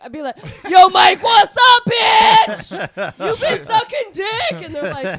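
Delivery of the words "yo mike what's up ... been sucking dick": loud brash shouted greeting, much higher; tough prison yell. Retold or imitated. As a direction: bark it across the visiting room like a tough girlfriend.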